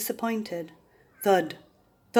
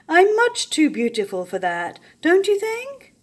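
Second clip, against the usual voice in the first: vain preening; 8 semitones higher, 7 dB louder than usual. A narrator's reading. Vain warthog admires himself.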